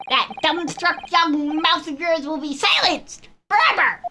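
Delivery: high, taunting villain voice; menacing prosecutor. Acted. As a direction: sneer it like a smug villain.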